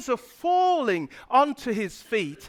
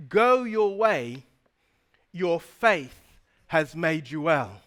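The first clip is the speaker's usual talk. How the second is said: slow, lowered, with long pauses; solemn gentle pronouncement; 5 semitones lower than usual. Retold or imitated. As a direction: pronounce it calmly, phrase by phrase. Preacher voices Jesus healing the blind man.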